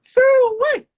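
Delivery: high, piercing hog holler; country hog call. Acted. Country boy calling out, high hog holler. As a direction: call the pigs in from across the farm.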